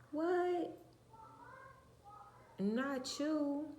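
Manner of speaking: high-pitched, incredulous exclamation; mock shock. Speaker reacting to relatives' gossip, mock shock. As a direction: feign scandalized disbelief, pitch leaping way up.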